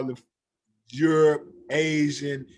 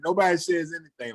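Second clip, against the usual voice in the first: ordinary delivery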